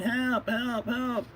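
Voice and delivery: high, falsetto cries for help; mock scream for help. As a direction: cry out like a cartoon damsel. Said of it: "she's screaming".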